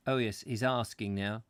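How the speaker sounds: ordinary delivery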